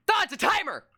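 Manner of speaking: high-pitched cry of dismay; panicked gamer exclamation; alarmed outburst. Real, not acted. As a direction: yelp it as a nasty discovery. Discovers a timer in game.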